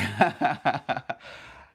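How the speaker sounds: loud, drawn-out real laugh. Real, not acted. Streamer amused by advice to laugh at everything, laughing. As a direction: burst out laughing.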